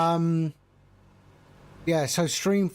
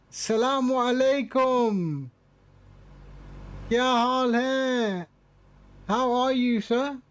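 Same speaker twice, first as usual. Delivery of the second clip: put-on Pakistani accent, raised pitch; 5 semitones higher than usual. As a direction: greet him in a Pakistani accent. Greeting a follower in Urdu.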